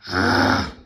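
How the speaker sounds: low growling ugh; grumpy bear growl. Acted. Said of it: "Bear growled".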